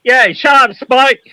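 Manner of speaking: loud, high mock outburst at Spike; playful shout. Real, not acted. Shouting at Spike in jest, loud and high. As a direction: yell it at your mate, half laughing.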